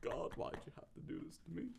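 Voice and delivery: soft, tearful imitation; quiet mock weeping; mock crying. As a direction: plead through faked tears. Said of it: "he's crying a little bit".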